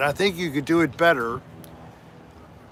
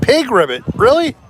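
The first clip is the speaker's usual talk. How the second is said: surprised; 9 semitones higher, 8 dB louder than usual. Real, not acted. Odd viewer name read out.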